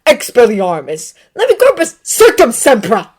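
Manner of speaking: loud spell-casting shout. Acted. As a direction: hurl the spells at full volume.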